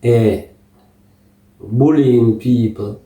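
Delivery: ordinary talk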